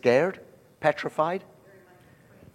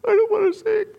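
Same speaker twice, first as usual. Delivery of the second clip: much higher, wailing mock complaint; whiny nervous singer; 4 dB louder than usual. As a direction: whimper it like a scared singer. Mocking nervous singers this morning.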